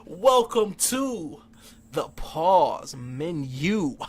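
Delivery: very slow, drawn-out show intro; announcer voice. Acted. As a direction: stretch every word like a game-show host. Mock grand show opening.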